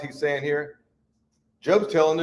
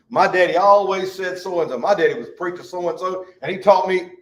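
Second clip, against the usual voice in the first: louder, higher imitation of a boaster; mock boasting; 4 semitones higher, 6 dB louder than usual. Churchgoer citing a preacher daddy, mock boastful insistence. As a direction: insist on it, puffed up with borrowed authority.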